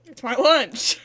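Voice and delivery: talking while eating; mouth full. Real, not acted. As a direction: say it through a bite. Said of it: "It's so loud, Sapnap".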